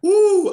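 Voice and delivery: high, loud whoop of hype; excited whoop. Real, not acted. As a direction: let out a big woo.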